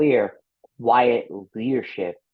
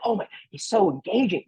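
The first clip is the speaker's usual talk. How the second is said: high admiring gush; impressed listener; 10 semitones higher than usual. Retold or imitated. Imitating a listener charmed by energy. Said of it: "but you're like".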